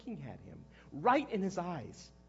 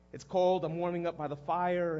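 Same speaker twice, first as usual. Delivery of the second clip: ordinary delivery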